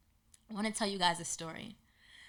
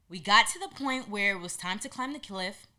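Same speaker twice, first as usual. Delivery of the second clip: ordinary delivery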